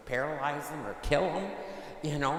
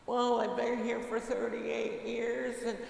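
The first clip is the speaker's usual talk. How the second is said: whiny excuses; 7 semitones higher than usual. Retold or imitated. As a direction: whine out every excuse as the sick man. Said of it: "And what's he say?".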